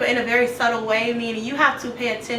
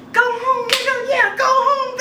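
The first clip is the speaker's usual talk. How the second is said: mocking taunt; 11 semitones higher, 3 dB louder, slower than usual. [name] taunting through the door.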